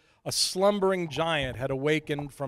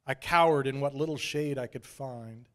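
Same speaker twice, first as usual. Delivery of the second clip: plain reading voice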